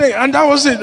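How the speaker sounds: ordinary talk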